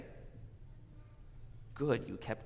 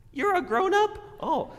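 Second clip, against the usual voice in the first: mock surprise; 11 dB louder than usual. Mock surprise at child's answer.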